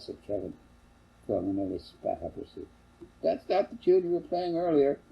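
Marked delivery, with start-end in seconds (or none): 3.2-5.0 high, mocking jab; teasing mother. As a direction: tease him gently. Mother teases her son.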